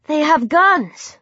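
high, drawn-out protest; worried objection, stretched out. A narrator's reading. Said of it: "Cindy protested".

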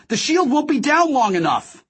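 high urgent protest; very high-pitched yell; alarmed shout. A narrator's reading. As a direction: shout a desperate warning. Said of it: "I shouted".

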